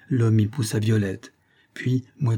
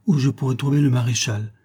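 usual speaking voice

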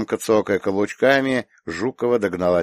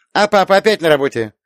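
put-on high, louder child's voice; curious little girl; 10 semitones higher, 7 dB louder, faster than usual. A narrator's reading. Little girl asks her aunt about papa, curious. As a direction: ask like an inquisitive small girl.

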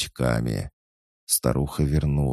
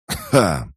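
sharp waking gasp; 4 semitones higher, 6 dB louder than usual. Acted.

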